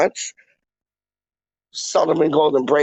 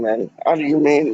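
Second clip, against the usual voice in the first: ordinary talk, nothing marked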